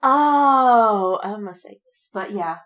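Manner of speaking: usual speaking voice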